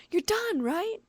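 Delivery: high squeaky echo of the stalker; mocking mimic. A narrator's reading. Shigure mimicking the stalker's shrill plea. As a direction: parrot her teasingly.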